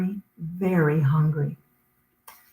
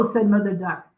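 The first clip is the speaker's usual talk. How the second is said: plain reading voice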